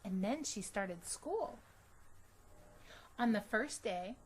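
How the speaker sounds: ordinary delivery, nothing marked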